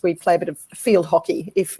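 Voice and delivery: ordinary delivery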